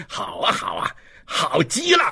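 mocking laughter. Inspector ridiculing the offer, laughing scornfully. Inspector mocks the prisoner's request.

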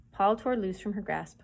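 plain reading voice

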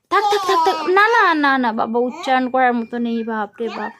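put-on bright, loud sing-song quizzing of a toddler; loud child-directed teaching. Mother quizzing her toddler on letters, in a loud sing-song. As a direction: coax the little one through letters and numbers, loud and eager.

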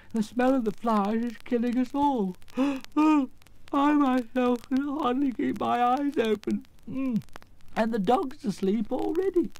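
high, slow, effortful lion voice with gasps; drowsy, struggling lion. Acted. Lion overcome by poppy scent, drowsy and gasping. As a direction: fight sleep, gasping and dragging the words out.

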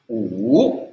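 "U" dipping low, then rising; nada tiga. Shown as a demonstration. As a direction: sink on "U" and swing back up. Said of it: "Itu ada tiga". ("U" nada tiga, turun lalu naik)

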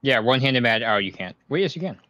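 ordinary talk

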